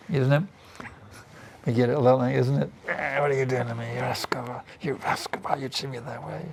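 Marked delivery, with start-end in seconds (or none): 2.9-6.4 hushed mock complaint. Acting out angry reaction to mistreatment.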